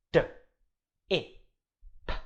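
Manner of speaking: D, I, P said as separate sounds; sounded out slowly. Shown as a demonstration. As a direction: segment D I P slowly. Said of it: "Let's sound it out slowly".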